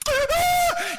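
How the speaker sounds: very high, loud shriek; imitated child's scream. Little girl comes up through the wave, imitated screaming. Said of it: "she's screaming".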